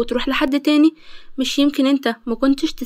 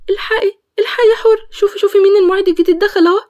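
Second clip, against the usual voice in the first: higher, fast and urgent, a touch tearful; excited alarm; 5 semitones higher, 3 dB louder, faster than usual. Acted. Karma urgently alerting Hoor to the new assistant, excited alarm. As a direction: grab her friend's attention in a rush.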